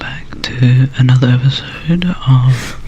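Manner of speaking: slow, drawn-out whisper; ASMR whisper. Pretend. Co-host parodies an ASMR episode intro, slowly whispering. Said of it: "how people think that A S M R".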